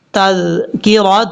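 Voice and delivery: ordinary talk, nothing marked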